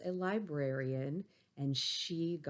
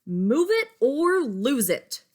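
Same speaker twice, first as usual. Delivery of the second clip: slow, much higher, loud barked order; stern teacher shout; 10 semitones higher, 13 dB louder, slower than usual. Acted. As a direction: bark it like a furious teacher. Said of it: "she's saying to him".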